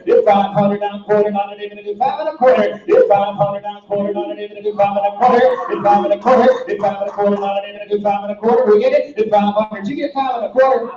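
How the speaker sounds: ordinary delivery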